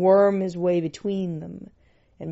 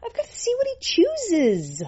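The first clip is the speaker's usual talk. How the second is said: put-on much higher, urgent young woman; high girlish voice. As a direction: pitch up into Jimmy's voice. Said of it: "Jimmy waved Death off".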